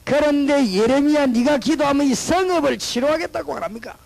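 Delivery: loud, high, slow divine call; voice of God. Acted. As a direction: boom out God's promise to Jeremiah. God speaking to Jeremiah. (예레미야를 부르는 하나님의 장엄한 목소리)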